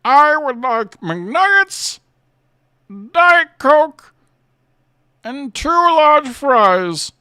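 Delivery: very slow, loud, high Bane voice; Bane ordering McDonald's. Pretend. Bane ordering a fast-food meal, hammed-up and booming. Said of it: "To Bane ordering McDonald's".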